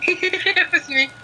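high giggling admission; words squeezed through high laughter; laughing confession. Real, not acted. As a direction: crack up while owning up.

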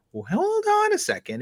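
high-pitched "wait a moment" reaction; sudden second thought. Retold or imitated. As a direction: act out catching yourself mid-thought, voice leaping up. Acting out his own second thought.

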